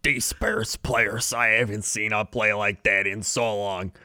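mock Chicago ex-player voice. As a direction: lean into a Chicago sports-radio guy.

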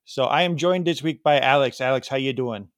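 British impression that comes out Wisconsin; mock British accent. Host introducing himself and his guest, in a mock British, really Wisconsin, accent. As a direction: put on a bad British accent with a Wisconsin twang. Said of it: "that's my best British impression".

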